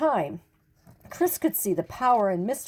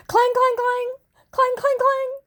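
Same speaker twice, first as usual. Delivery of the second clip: much higher, loud ringing clang; imitated bell clanging; 6 dB louder than usual. A narrator's reading. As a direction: ring it out like a bell. Boy rings his bell.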